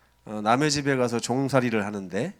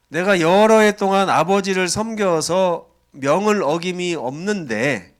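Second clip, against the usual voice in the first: angry grievance; 5 semitones higher, 6 dB louder, slower than usual. Acted. Older brother's bitter complaint.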